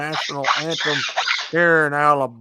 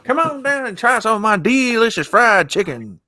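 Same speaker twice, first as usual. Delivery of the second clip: Colonel Sanders; 8 semitones higher, 7 dB louder than usual. Acted. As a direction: sell the chicken like an old Southern colonel. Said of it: "Colonel Sanders".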